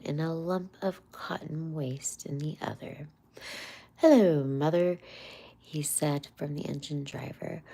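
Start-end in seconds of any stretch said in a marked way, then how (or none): 4.0-5.0 loud, cheery driver's hail; hearty hello. A narrator's reading. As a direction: call out across the engine. Engine driver greets Toad.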